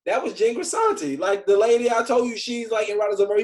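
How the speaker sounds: high, loud, defensive recap; exasperated explaining. Retold or imitated. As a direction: insist as if it were obvious, acting out the argument. Acts out defending himself to friend.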